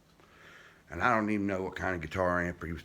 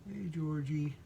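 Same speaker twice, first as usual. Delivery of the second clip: cooing to a pet; 9 semitones higher, slower than usual. Real, not acted. Calling to pet gecko.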